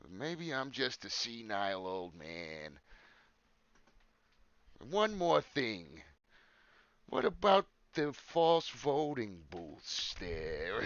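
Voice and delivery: slow, rumpled detective musing; Columbo impression. Doing Columbo as a debate moderator, slow and rumpled. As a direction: drawl each word like a scruffy detective. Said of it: "I was gonna do a Columbo".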